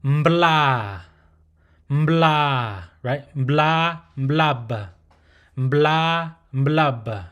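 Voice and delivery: mbla loud with a nasal m, l and open a; mbla and mblab. Shown as a demonstration. Hum, blend b and l fast. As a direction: mblab closed on b. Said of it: "consonant is pronounced".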